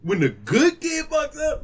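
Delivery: disappointed parent. Acted.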